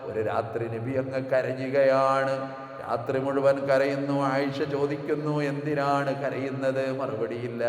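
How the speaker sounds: loud, slow sung sermon chant; fervent melodic preaching with recited verses; preaching at full force. Acted. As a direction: pour out the story as a rising chant.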